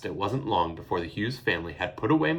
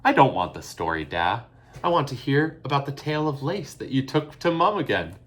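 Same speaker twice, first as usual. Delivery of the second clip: insisting; 5 semitones higher, 6 dB louder than usual. A narrator's reading. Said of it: "Eilish insisted".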